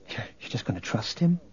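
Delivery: soft, doubting whisper; whispered question. A narrator's reading. Leia questions Luke about freeing the prisoner, whispering. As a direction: whisper it under your breath. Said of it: "Leia whispered to Luke".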